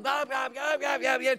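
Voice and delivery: high-pitched rapid yammering; imitated yelling tirade; mock yelling. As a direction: mimic someone ranting away in a high voice. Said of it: "And she's yelling".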